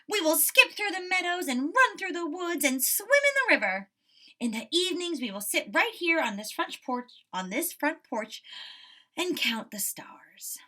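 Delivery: teacher voicing Frog's happy plans, fading to a whisper; dreamy excited Frog. A narrator's reading. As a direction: paint the summer days, then hush.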